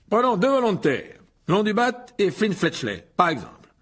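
high, slow showman's call; showy professor. A narrator's reading. Lockhart picks two volunteers before the class, high and showy. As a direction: announce the volunteers grandly.

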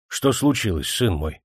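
ordinary delivery, nothing marked

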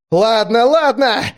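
high, loud, exasperated yell; shouted surrender. A narrator's reading. Driver giving in and flooring the gas, shouting. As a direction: yell it, giving in under pressure. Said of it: "выкрикнул он".

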